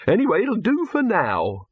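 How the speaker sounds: high-pitched, fussy director voice; exasperated film director. Acted. Director tells the crew to move on, exasperated.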